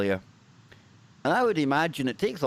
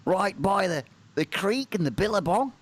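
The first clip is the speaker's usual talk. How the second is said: a light mock Australian accent, pitched up; 4 semitones higher than usual. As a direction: tease in an Aussie twang. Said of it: "could you just not listen to that accent".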